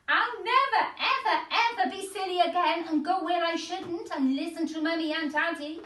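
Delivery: high, fast earnest pleading; a sorry little girl vowing; Goldilocks promising. Acted. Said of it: "said Goldilocks".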